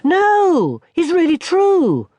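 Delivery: put-on high, slow little-boy voice; a young boy explaining; small boy. Barney tells his grandmother about his fall, high little-boy voice.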